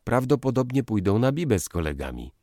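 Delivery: high, quick, airy remark; breezy lead-in with a needling edge; light, casual. A narrator's reading. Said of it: "zaczął lekko".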